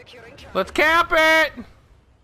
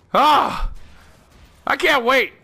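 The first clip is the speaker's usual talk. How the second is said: usual speaking voice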